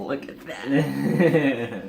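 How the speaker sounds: mock-impressed, draw it out; drawn-out look at that. Co-host points at anime quote, stretching it out, mock-amazed.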